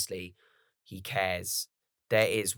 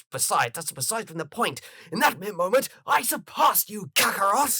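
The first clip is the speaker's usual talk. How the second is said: rising into a much higher, louder boast; smug mock Vegeta; 9 semitones higher, 4 dB louder than usual. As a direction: brag to save face. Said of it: "He turns around and goes".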